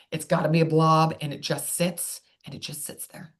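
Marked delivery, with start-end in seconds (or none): 1.2-3.3 fading to whisper. Shown as a demonstration. Speech therapist showing the tongue resting, fading to a whisper. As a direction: let it trail off into a whisper. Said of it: "Notice very minimal tongue movement".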